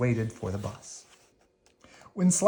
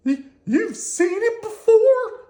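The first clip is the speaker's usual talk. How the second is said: very high, loud stammering squeal; Slimy stammering; 7 dB louder, slower than usual. Acted. Slimy can't believe Squirmy's calm, in a very high stammering squeal. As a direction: stutter in disbelief.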